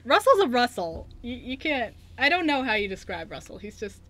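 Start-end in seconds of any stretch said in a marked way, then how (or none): none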